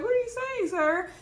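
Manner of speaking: high-pitched, incredulous question; shocked disbelief. Retold or imitated. Wife hearing her husband will become a preacher, imitating shocked disbelief.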